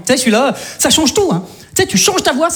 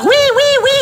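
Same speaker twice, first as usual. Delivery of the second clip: much higher excited yeses; audience cheering; faster than usual. Pretend. Comedian playing his own audience, high cheering. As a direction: cheer like your own crowd. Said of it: "je fais aussi mon propre public".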